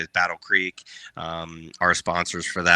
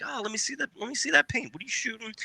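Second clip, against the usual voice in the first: high, soft sneaky voice; high, sugary feigned interest; fake-friendly act; 5 dB quieter, faster than usual. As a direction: play innocent while you pull the prank.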